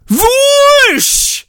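high whooshing "Vwoosh" swept down; mock sound effect, high and falling. Shown as a demonstration. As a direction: swoosh it high like a lightsaber. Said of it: "that's what it says".

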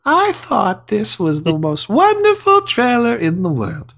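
high airy reading of a fan review; lightly mock effeminate read. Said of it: "in a very effeminate voice".

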